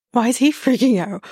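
usual speaking voice

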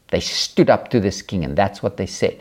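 ordinary talk, nothing marked

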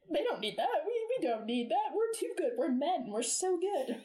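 high, singsong mocking of self-satisfied men; hammed-up boastful mimicry; mock cocky men. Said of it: "the men in the sixties and seventies".

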